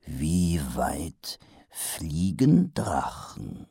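put-on as if asking a child; Vimes, slowly as to a child; slow and deliberate. A narrator's reading. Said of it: "so langsam und betont deutlich".

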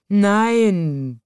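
a long yawned no; yawning. A narrator's reading. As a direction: yawn right through the word. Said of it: "gähnte er".

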